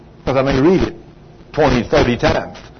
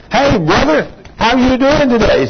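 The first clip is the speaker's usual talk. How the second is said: higher-pitched acted hello; cheery church greeting; 8 semitones higher than usual. One churchgoer greeting another on walking in, acted cheery hello. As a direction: greet a fellow churchgoer in a lifted voice.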